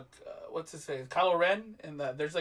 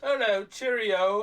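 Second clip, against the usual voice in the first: slow, put-on British accent; hammed-up British greeting; mock British accent; slower than usual. Mocking a British actor trying American, hammed-up British accent. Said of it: "British guy trying to do a, an American accent".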